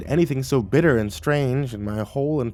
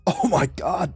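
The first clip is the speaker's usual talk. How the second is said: gasping exclamation; 5 semitones higher, slower than usual. A narrator's reading. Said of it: "I gasped".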